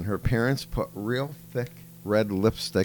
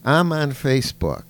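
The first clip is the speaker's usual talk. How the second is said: higher, louder mock child voice; a hammed-up schoolgirl presenter, pitched up; imitating a little girl; 5 semitones higher, 5 dB louder than usual. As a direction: play the kid doing a grown-up speech, higher and louder. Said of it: "She goes".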